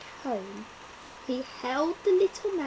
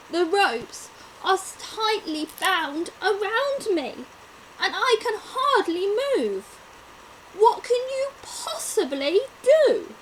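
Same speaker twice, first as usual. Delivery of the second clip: scared lion; 8 semitones higher, 7 dB louder than usual. Acted.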